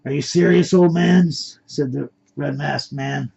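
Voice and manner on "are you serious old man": louder, scornful jeer; taunting question, pitched up; mocking. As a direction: raise the voice in mock disbelief. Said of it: "started to laugh as they approached".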